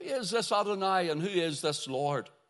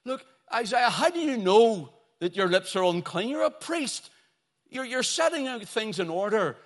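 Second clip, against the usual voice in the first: high, loud, pressing questions; incredulous challenge; 6 semitones higher, 5 dB louder than usual. Acted. Preacher questions the priest Isaiah about unclean lips, loudly incredulous. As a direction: argue with him in acted disbelief.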